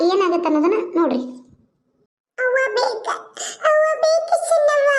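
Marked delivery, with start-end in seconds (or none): 2.4-3.1 put-on higher child's whimper for mother; child crying. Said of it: "ಅಳ್ಬಾರ್ದು". 3.7-4.9 put-on much higher, slow wailing child's cry. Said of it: "ಅಳ್ಬಾರ್ದು".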